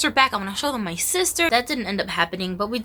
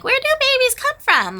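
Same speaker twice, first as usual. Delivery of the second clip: high, louder mock little-kid question; naive kid; 5 dB louder than usual. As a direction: squeak it out like a clueless little kid.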